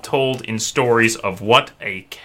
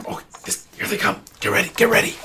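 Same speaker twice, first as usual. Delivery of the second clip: distant whispery voice; 9 semitones higher, faster than usual. Acted. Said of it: "you hear a voice go".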